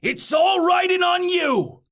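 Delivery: high, hollered pep talk; high-pitched sideline shout; coach's yell. A narrator's reading. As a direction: call it across the field like a coach. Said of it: "yelled Coach McDye".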